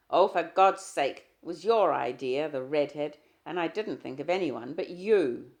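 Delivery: loud, slowed furious retort; angry outburst. Acted. Husband shouting at his jealous wife. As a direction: snap back in anger.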